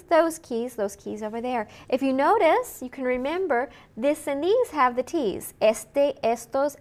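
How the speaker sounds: ordinary talk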